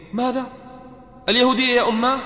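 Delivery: dying son aghast at his mother's advice, shocked; shocked disbelief. Acted. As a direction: recoil at what you just heard.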